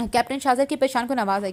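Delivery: ordinary delivery, nothing marked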